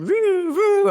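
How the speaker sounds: high wailing police siren noise; siren imitation. Pulled over on the coast, a mock siren. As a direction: mimic the siren wail.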